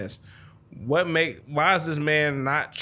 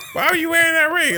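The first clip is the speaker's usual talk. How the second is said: much higher and louder suspicious question; a hammed-up woman's accusing voice; mock suspicious woman; 9 dB louder than usual. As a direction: squeak it out like a woman catching you.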